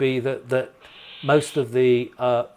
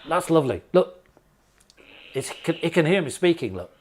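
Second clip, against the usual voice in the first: ordinary talk, nothing marked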